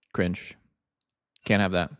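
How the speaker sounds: ordinary talk, nothing marked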